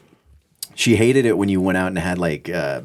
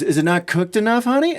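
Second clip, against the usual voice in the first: high-pitched, sweet-talking grandma question; lilting mock grandmother, climbing very high at the end; imitated grandmother; 13 semitones higher than usual. Grandmother questioning her tripping grandson.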